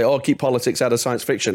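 ordinary talk, nothing marked

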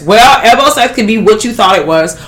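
ordinary delivery

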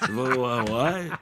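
softly slurred, dazed imitation; mock a drugged, slow-to-react host, drawing out the last word; narcotized Sean Hannity. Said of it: "he's kinda narcotized".

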